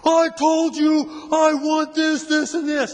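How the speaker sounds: high, loud barked demands, slowed; a cop yelling at a stranger for his ID; angry cop demands. Retold or imitated. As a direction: bark out each demand like a cop. Retold cop repeating demand.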